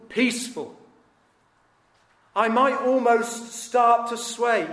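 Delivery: loud, high, slow swelling feeling; mockingly swept up, drawing it out; mock rapture. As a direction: parody someone getting lost in the music. Mimics worshipper swept up in music.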